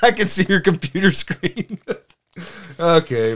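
laughing. Real, not acted. Laughing after joke.

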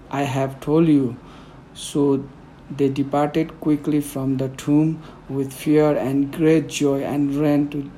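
ordinary talk

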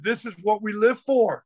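high, pumped-up locker room cry; rally the team, all fired up; fired-up pep talk. Retold or imitated. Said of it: "I was so fired up".

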